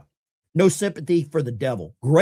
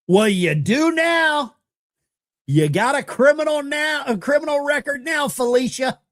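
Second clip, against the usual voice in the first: gloating taunt; 8 semitones higher than usual. Real, not acted. Mocking the woman in the clip.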